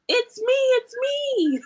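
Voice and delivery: high, slow, sing-song reveal; squeaky little animal cheering it's me. Acted. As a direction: pop out from behind the mask. Pig revealed under mask.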